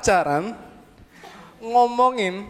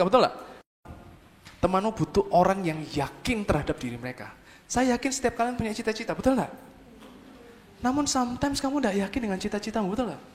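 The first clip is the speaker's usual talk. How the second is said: ordinary talk, nothing marked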